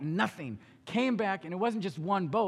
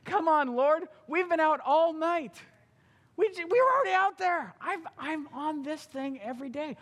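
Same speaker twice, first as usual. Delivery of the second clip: exasperated protest; 8 semitones higher than usual. Acted. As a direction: whine that you've fished all night. Peter protests to Jesus.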